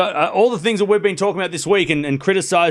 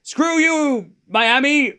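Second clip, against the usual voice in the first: mock jeer; 11 semitones higher, much slower than usual. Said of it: "we're all like".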